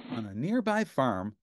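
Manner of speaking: usual speaking voice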